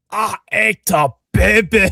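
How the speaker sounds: slow, high, laughing Fat Bastard voice. Acted. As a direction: ham up the Scottish glutton. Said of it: "Fat bastard".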